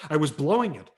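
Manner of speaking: ordinary delivery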